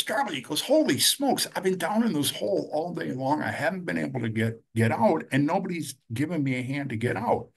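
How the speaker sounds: high, exasperated cry from down the hole; desperate call. Retold or imitated. Joe calls up to his buddy Charlie, high and exasperated. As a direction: call up to your friend, fed up after a whole day stuck.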